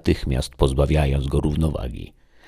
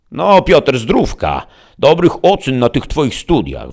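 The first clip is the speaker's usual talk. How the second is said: high, loud rustic granny; old granny; 11 semitones higher, 7 dB louder, slower than usual. A narrator's reading. Grandma giving Piotr wishes for his studies, granny voice. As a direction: wish it heartily in a high old-woman voice. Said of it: "wymamrotała starowinka".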